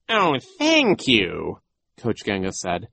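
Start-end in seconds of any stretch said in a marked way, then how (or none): 0.1-1.6 slow, louder theatrical thanks; flattered villain. A narrator's reading. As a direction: draw out the thanks. Villain accepts a compliment.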